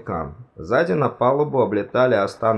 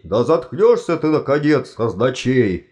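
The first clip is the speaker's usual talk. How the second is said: louder, higher exasperated outburst; angry roar; 3 semitones higher, 4 dB louder than usual. A narrator's reading. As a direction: roar at him to be silent. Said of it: "рыкнул чудакулли". (раздражённый рык)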